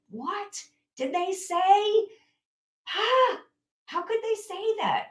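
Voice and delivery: high, slow, outraged disbelief; an upset parent wailing at what they said; upset outburst. Acted. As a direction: cry out at how unfair it was. Acting out being triggered.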